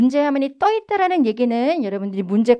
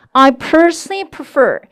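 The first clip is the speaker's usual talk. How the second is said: loud, slow model for repeating; strongly projected teacher demo; 2 semitones higher, 6 dB louder, much slower than usual. Shown as a demonstration. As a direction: push the sound out past a mask. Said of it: "마스크 밖으로 음성 뽑아볼게요".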